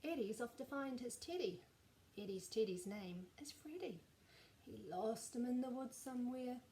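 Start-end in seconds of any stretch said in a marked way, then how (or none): none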